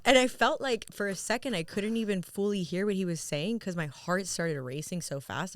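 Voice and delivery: ordinary delivery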